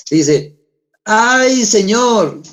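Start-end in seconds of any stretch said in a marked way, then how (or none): 1.0-2.4 high-pitched whiny ay Señor; lamenting Moses. Acted. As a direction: cry out like a reluctant Moses complaining. Moses complains to God again.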